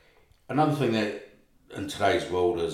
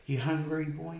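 put-on higher, motherly question to a kid; caring auntie voice; 8 semitones higher, 3 dB quieter than usual. His lady offering food to kid.

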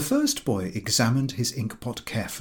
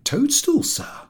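surprising answer; 4 dB louder, slower than usual. A narrator's reading. Boy's unexpected answer.

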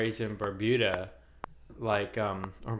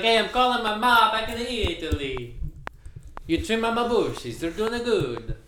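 put-on Italian accent; 11 semitones higher, 5 dB louder than usual. Imitating his summer boss, loud mock Italian accent. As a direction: imitate the Italian boss's broken English. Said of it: "He was like".

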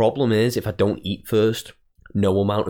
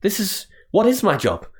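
high, rising panic over her own job; panicked rant; 11 semitones higher than usual. Retold or imitated. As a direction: spiral into comic panic. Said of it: "That's a little complex that I have".

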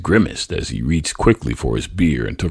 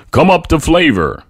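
higher, louder TV ad announcer; beckoning announcer; 13 semitones higher, 6 dB louder than usual. A narrator's reading. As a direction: lure them in like a commercial voice. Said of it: "the announcer beckoned".

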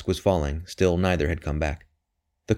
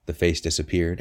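usual speaking voice

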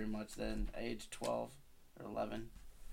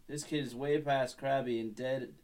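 ordinary delivery, nothing marked

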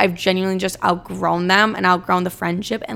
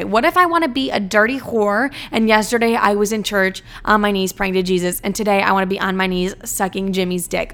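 ordinary delivery

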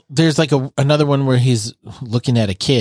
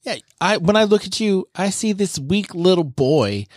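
taunting; 7 semitones higher than usual. Acted. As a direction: sneer at a weak little brother. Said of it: "he kinda starts to taunt Zeke".

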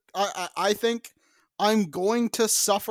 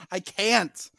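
normal talking voice

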